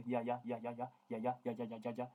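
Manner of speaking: ordinary talk, nothing marked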